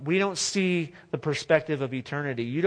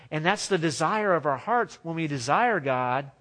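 ordinary talk

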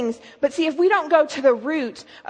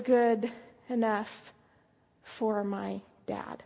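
slow, broken-up and sinking softer; halting mid-sentence; 4 semitones lower, 9 dB quieter, much slower than usual. Retold or imitated. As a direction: imitate her stopping between words, voice dropping away. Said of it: "she said it just like that".

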